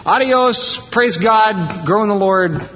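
high, cheery send-off; breezy farewell. Retold or imitated. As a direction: wave them off lightly. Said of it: "And I'm like".

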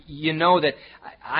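ordinary talk

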